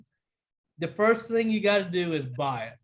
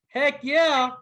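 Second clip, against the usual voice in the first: high, loud heck yeah; excited; 6 semitones higher, 5 dB louder than usual. Real, not acted. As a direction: cheer it out. Excited about legislation news.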